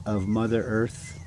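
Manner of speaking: ordinary delivery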